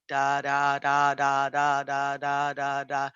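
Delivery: every da the same weight, mechanical; robotic rhythm. Shown as a demonstration. Teacher showing students the wrong, robotic way, flat and even. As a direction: chant it like a machine, no lilt. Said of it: "We don't wanna just be very robotic".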